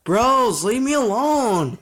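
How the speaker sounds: loud, high, drawn-out wail of protest; frustrated shout. Real, not acted. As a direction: shout at them to back off, stretching the words. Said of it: "I'm getting frustrated".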